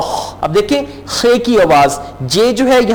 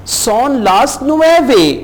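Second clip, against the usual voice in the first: Son las ocho stretched out slowly; slow, careful Son las ocho; very slow model phrase; 3 semitones higher, much slower than usual. Shown as a demonstration. Teacher slowly models telling the time. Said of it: "teacher models a time phrase".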